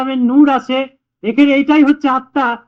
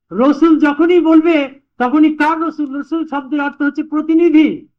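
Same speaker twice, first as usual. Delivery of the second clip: normal talking voice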